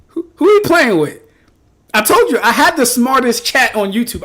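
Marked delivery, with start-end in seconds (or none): 0.1-1.2 hyped-up. Real, not acted. Chat roasting a viewer.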